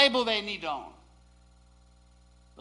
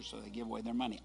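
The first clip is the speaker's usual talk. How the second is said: ordinary talk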